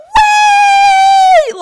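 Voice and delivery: very high, loud shrieking yell; yelling wait. Retold or imitated. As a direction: scream it as you fall. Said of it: "Like, I was yelling".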